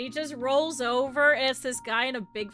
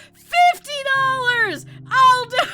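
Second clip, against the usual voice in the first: passionate mock shout; 9 semitones higher, 12 dB louder than usual. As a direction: imitate a stranger barging in, shouting. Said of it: "he's just so passionate".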